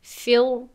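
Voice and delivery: فیل said with a thick, emphatic final r; the heavy, full-mouthed r in فیل; wrong heavy r. Shown as a demonstration. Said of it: "این نادرست است".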